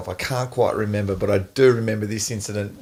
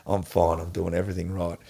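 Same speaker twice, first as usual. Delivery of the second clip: soft, low inner voice repeating the rule; coach's words in his head; 7 semitones lower, 6 dB quieter than usual. Retold or imitated. Player reminding himself of the coach's rule, softly. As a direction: murmur the coach's rule to yourself, much softer. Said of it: "I've got Neil's words ringing in my ear".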